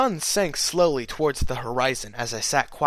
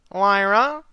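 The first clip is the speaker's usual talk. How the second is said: questioning; 8 semitones higher, 6 dB louder than usual. A narrator's reading. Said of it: "Bon Bon questioned".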